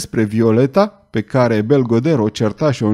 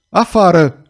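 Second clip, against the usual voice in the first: a loud, higher roar; 5 semitones higher, 4 dB louder than usual. A narrator's reading. Belgoder roaring at the girl to get out. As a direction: bellow her out. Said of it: "urlă el".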